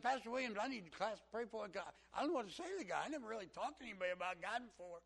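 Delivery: high, loud country fellow; country accent. Retold or imitated. Country man asking class for prayer, loud country accent. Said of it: "he just talked like this".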